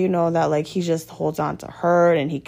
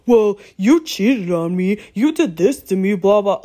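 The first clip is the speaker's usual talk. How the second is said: louder, higher mocking take on the boyfriend's accusing whine; 4 semitones higher, 5 dB louder than usual. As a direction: sneer it back the way he says it. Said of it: "And then he'd be like".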